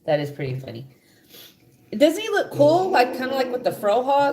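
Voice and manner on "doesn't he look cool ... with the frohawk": excited. Real, not acted. Excited about frohawk idea.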